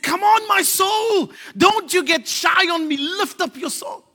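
high, loud, slow rallying cry; rousing call. Acted. Preacher to church, loud rousing call. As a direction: rouse your own soul, shouting.

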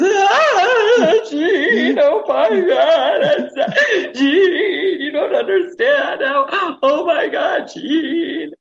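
high, loud sobbing reenactment, laughing through tears; ugly crying. Retold or imitated. Overwhelmed traveller thanking Jean after lost suitcase, ugly sobbing. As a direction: wail her name, half laughing, half sobbing. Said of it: "I mean, just sobbing".

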